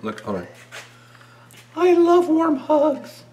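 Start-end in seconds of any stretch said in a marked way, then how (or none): 1.7-3.2 very high, slow, squeaky cartoon voice; squeaky snowman voice. Silly voice, Olaf-like line.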